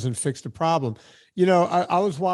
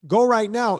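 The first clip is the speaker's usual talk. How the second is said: higher, louder insistent push; urgent command; 6 semitones higher, 5 dB louder than usual. Retold or imitated. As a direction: press them to act right now. Urging parents to act now.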